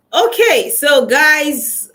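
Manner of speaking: loud, high hype call; rally the viewers, voice up; excited. Real, not acted. Host hyping up her live audience, loud and high.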